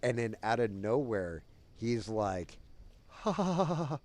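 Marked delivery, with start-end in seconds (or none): 3.1-4.0 high, louder staccato ha-ha laugh; imitated giggle. Friend coming out of nitrous, imitated high giggle. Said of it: "that's how he laughed".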